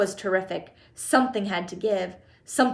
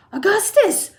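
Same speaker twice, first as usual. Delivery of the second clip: high, loud motherly shout; 10 semitones higher, 8 dB louder than usual. A narrator's reading. Missus Gloop shouts to her son, high shout. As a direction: shout the boy's name in alarm. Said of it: "shouted Missus Gloop".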